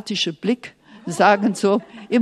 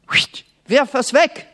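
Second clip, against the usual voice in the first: higher, louder sharp command; God commanding; 4 semitones higher, 5 dB louder than usual. Acted. God orders Moses to throw the staff, loud command. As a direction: fling the order out, brisk and loud. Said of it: "Und Gott sagt".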